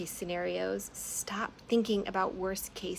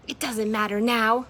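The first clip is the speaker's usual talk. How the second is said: much louder angry shriek; 4 semitones higher, 10 dB louder than usual. Acted. Moth shrieking at Marzana with a knife, much louder. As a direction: shriek it at her in rage. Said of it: "Moth shrieked".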